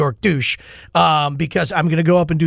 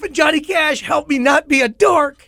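much higher, loud, stretched-out cheer; relieved triumphant shout; 11 semitones higher, 4 dB louder than usual. Retold or imitated. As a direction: celebrate like you just dodged disaster. Continues relieved celebration.

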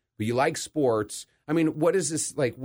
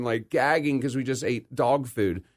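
usual speaking voice

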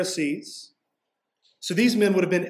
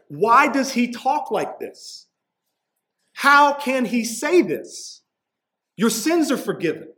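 indignant questioning; 6 semitones higher than usual. Retold or imitated. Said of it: "they're questioning".

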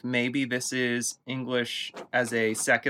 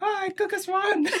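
high, dreamy pixie voice; whimsical high voice. Retold or imitated. Repeating the swan bit.

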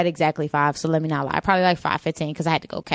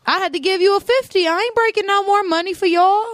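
higher, sassy and defiant; sassy defiance; 10 semitones higher than usual. Retold or imitated. To the nail tech, sassy and defiant. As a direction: tell him off with attitude.

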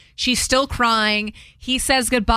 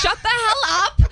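mock scolding through laughter; 9 semitones higher than usual. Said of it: "I'm like".

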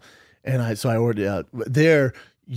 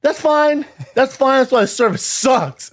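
much higher, louder gloating comeback; hammed-up smug jab; mock loud retort; 11 semitones higher, 6 dB louder, slower than usual. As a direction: fire back loudly, mocking. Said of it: "I went".

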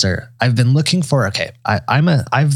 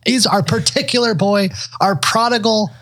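imagined staff, much higher and gushing; mock delight; 8 semitones higher than usual. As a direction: greet the regular like a returning hero. Imagined staff greeting regular.

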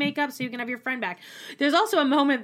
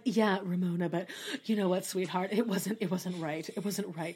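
lower, softer mock Luann; imitating Luann; 4 semitones lower, 6 dB quieter than usual. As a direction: purr it low like Luann. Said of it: "Luann's like".

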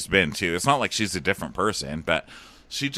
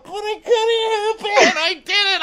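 mock crying; 4 dB louder than usual. Mocking Dylan's emotional confession.

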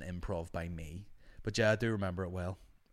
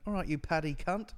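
mock English person; 8 semitones higher than usual. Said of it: "You speak to anyone in England".

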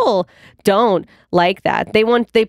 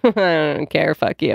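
friend's deep retort; 7 semitones lower, slower than usual. Retold or imitated. Best friend from New Jersey brushing off criticism, low and careless. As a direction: shrug it off gruffly. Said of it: "And she's like".

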